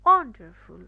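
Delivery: Wonderful with ON punched very loud; stress on first syllable. Shown as a demonstration. Teacher demonstrating stressed syllable, forceful first beat. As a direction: hit the ON of wonderful hard, let the rest fall. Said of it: "অনটার মধ্যেই জোর দিচ্ছি".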